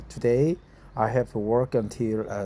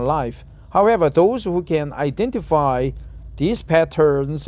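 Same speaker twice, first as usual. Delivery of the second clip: careful reading voice, a bit higher and louder than chat; reading an article aloud; 3 semitones higher, 4 dB louder than usual. Acted. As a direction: read the article out to practise English.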